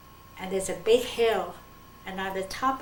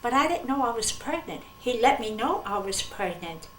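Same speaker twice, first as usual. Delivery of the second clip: normal talking voice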